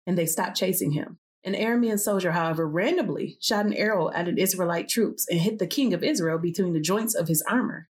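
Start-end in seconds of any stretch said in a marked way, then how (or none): none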